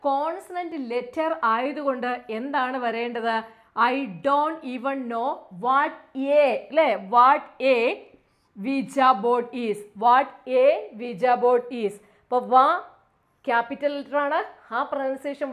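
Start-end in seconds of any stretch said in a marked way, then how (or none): 3.9-11.9 slow, louder reading with a punched; slow read-aloud. Shown as a demonstration. Teacher reading the answer sentence slowly and loudly. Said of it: "reads answer slowly".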